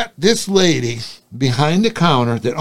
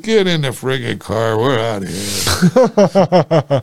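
gruff order; 5 semitones lower, slower than usual. Retold or imitated. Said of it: "I said".

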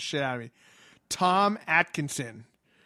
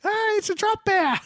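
very high, louder mock scream; mock panic; 7 dB louder than usual. Host acting a drop bear victim, high mock panic. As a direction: shriek it like the bear is falling on you.

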